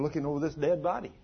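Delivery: ordinary delivery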